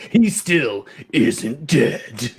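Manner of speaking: very slow ominous trailer narrator; horror trailer voice. Acted. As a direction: stretch it out like doom. Trailer parody continues.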